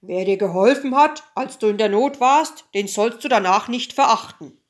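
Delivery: loud, stern royal rebuke; angry king. A narrator's reading. King rebukes his weeping daughter, loud and angry. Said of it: "Der König aber wurde zornig".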